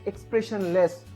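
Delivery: ordinary talk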